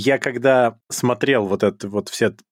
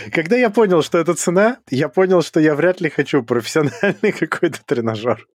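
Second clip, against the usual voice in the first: laughing through the words, pitched up; 5 semitones higher than usual. Real, not acted. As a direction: giggle while telling it. Laughing at the shock price.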